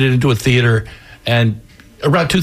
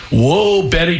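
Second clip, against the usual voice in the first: Charlie Wall; 6 semitones higher, slower than usual. A narrator's reading. Old boss to his driver after the arrow, alarmed shout. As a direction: call it out in alarm, voice lifted. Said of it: "Charlie shouted".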